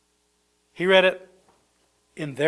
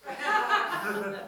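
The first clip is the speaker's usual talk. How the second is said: soft laugh; 9 semitones higher, 9 dB quieter than usual. Real, not acted. Laugh at the punchline.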